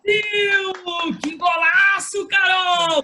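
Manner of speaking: long high roaring goal call; explosive goal commentary. Shown as a demonstration. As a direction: scream the goal, stretch it out. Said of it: "vamos lá".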